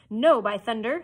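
loud, defiant refusal; loud cry. A narrator's reading. Starkey refuses Hook, loud defiant cry. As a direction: shout back in refusal. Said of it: "Starkey cried".

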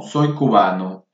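normal talking voice

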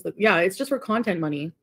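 ordinary delivery

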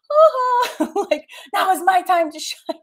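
high laughing mock triumph; gloating laugh. Mom gloating that her calm boys now win, laughing. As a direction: crow it through laughter. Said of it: "And now I'm like".

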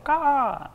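sudden high screeching "Ah!" like an eagle; eagle cry. Shown as a demonstration. Preacher imitating an eagle's cry for the congregation. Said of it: "like the cry of an eagle".

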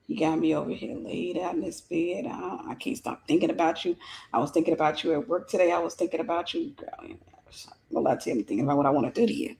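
low, soft pillow talk; imitating a lovestruck man's low murmur; mock love-bomber. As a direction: croon sweet nothings down low, like a smitten suitor. Acted love-bombing pillow talk.

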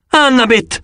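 high cry with falling pitch; yelled name. A narrator's reading. Narrator calls after vanishing Annabeth, yelling.

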